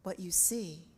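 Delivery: measured, projected pulpit reading with emphatic key words; prepared sermon delivery. Real, not acted. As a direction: preach it to the congregation, phrase by phrase.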